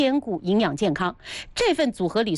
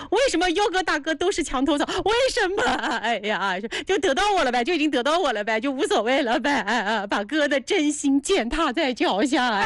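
为什么 pushed up higher in a whiny, hurt complaint; mock grievance; 5 semitones higher than usual. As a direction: whine about being taken for granted, hammed-up.

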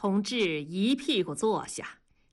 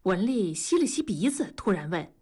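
ordinary delivery

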